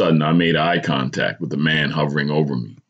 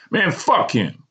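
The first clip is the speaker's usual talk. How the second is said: high-pitched dismissive retort; shooting back; 12 semitones higher, slower than usual. A narrator's reading. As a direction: fire it straight back, irritated. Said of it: "I shot back again".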